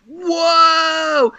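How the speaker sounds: long, high cry of whoa; excited whoa. Retold or imitated. Fan yelling at the camera, high drawn-out whoa. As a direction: wail it out like a cartoon reaction. Mock movie-sign cry at Joel.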